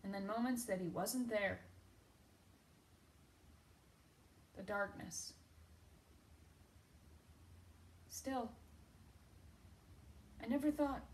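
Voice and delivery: very soft, halting recollection; hushed grieving. Acted. Recalling the dead husband's darkness, hushed and halting. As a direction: let it sink almost to nothing, with long pauses.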